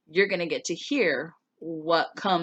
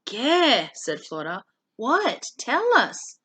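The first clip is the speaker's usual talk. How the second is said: higher, livelier read-aloud voice for the class; story narration; 4 semitones higher, 4 dB louder than usual. A narrator's reading. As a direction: read the chapter to students with animated lilt. Reads novel chapter aloud.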